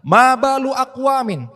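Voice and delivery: high, loud and slow Arabic rebuke; preaching from the pulpit. Retold or imitated. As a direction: proclaim it slowly to the crowd. Rasulullah berkhutbah di mimbar. (seruan khutbah keras dan lambat)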